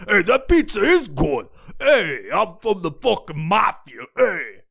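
hammed-up New York Guido; mock Guido accent. Guidos bragging about pizza and mafia, mock Guido accent. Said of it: "a bunch of fucking Guidos going".

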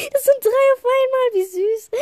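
high, loud delighted squeal; thrilled and gushing; excited squeal. Real, not acted. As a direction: gush over how cute they are. Sees three cute creatures.

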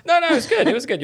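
high, loud quick reassurance; eager reassurance. Real, not acted. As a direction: rush to reassure her. Reassures cohost about her voice.

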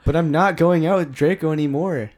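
hammed-up fan fiction reading; dramatic reading. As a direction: read it like a melodramatic teen diary. Reads fan fiction in character.

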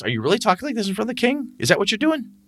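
high, fast mock disbelief; high-pitched mock scolding; incredulous GM.

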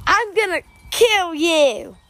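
Random Fact Joe voice. Acted. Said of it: "And I'm Random Fact Joe".

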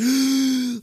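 a sudden high gasp for first air; newborn gasp. Retold or imitated. Preacher acting a newborn's first breath, high gasp. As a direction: gasp like a baby just slapped on the back.

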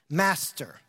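low, weary Master; a tired fisherman's sigh; frustrated sigh. Retold or imitated. Simon answers Jesus with tired frustration. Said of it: "You can hear the frustration in his voice".

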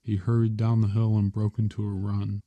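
usual speaking voice

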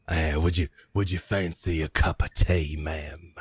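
a polite giant playing host. Tiefling offers tea in character.

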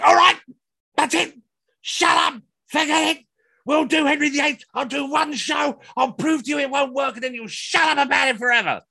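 high, loud exasperated yell; snapping shout. Retold or imitated. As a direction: explode at them, fed up. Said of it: "I must admit, I snapped".

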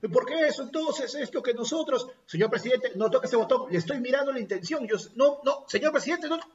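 congresista indignado con voz aguda de burla; quéjate al presidente atropelladamente, en tono agudo; imitando a Urresti reclamando. Retold or imitated. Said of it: "miraba al presidente del Congreso y decía". (parodia de reclamo parlamentario, agudo)

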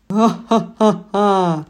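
loud, slow insane witch cackle; mad cackle. A narrator's reading. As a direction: burst into crazed laughter. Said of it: "разразилась безумным хохотом".

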